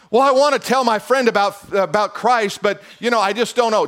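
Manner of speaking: high, loud fired-up preaching; preaching at full force. Acted. Pastor preaching hard to the congregation, fired up. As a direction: lift the room, pastor in full flight.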